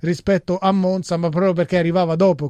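normal talking voice

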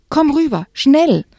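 high, loud panicked call; urgent shout. Acted. Will on the shore calling her off the ice, urgent high shout. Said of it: "schrie Will".

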